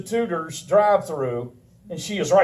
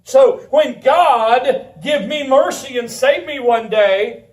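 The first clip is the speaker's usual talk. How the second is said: rising, louder, slowing preach up to a shout; preaching at full force; 6 semitones higher, 6 dB louder, slower than usual. Acted. Pastor urging his mothers to mercy, building to a shout. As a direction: drive it home to the congregation, building.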